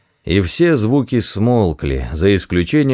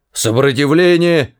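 shouting; 6 semitones higher than usual. Acted. Deep breath, then shouts.

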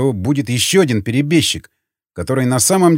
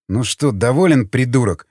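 usual speaking voice

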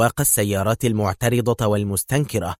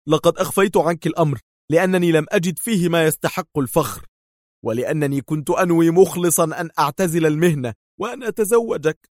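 voiced wounded hero; 6 semitones higher than usual. A narrator's reading. Wounded man explains to fiancée.

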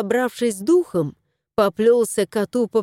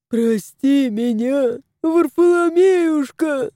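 mock-tearful wail, rising high; slow, lamenting plea breaking upward; sobbing; 5 semitones higher, slower than usual. A narrator's reading. House spirit begs the dying cat's forgiveness, wailing. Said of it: "рыдал домовой".

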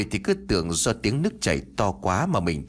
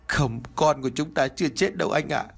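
higher, pleading through tears; tearful denial; 6 semitones higher than usual. A narrator's reading. Mother pleading with her husband that their son lives, tearful. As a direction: beg him to believe it.

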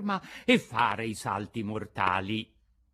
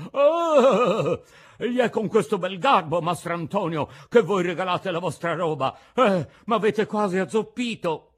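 loud, high indignant complaint; pained, angry outcry; 8 semitones higher, 9 dB louder than usual. A narrator's reading. Old man struck in the shins scolds his friend, loud pained anger. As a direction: cry out and scold at full voice.

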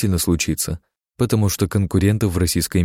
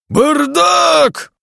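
пронзительный гневный крик во весь голос; заорать на весь цех, высоко и громко; вопль; 6 dB louder than usual. A narrator's reading. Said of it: "вопил Синицын". (яростный вопль)

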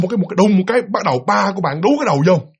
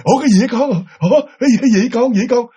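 higher, quick repeated questions; alarmed father; 5 semitones higher, 3 dB louder, faster than usual. Acted. Father anxiously asking what is wrong with his child, alarmed. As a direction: fire off a worried dad's questions.

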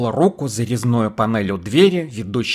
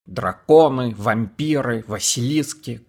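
plain reading voice

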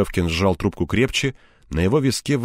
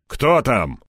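raised, higher-pitched challenge; habitual bark; 5 semitones higher, 4 dB louder than usual. A narrator's reading. Colonel answering a knock, barking out of habit. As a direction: bark it at the door. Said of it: "рявкнул полковник".

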